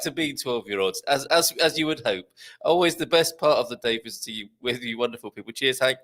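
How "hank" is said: normal talking voice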